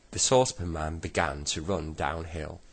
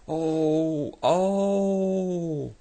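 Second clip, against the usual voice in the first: high, loud, drawn-out wail; long yelled "oh"; 12 semitones higher, 8 dB louder than usual. A narrator's reading. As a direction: yell a sustained "oh" in alarm. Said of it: "to yell".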